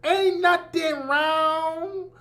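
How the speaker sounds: high, drawn-out Southern preacher singsong. Retold or imitated. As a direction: stretch every phrase in a mocking Southern preacher chant.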